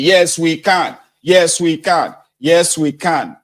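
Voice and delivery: high, loud chanted slogan; crowd shouting. Retold or imitated. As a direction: chant it back like a roaring crowd. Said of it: "And everybody will be shouting".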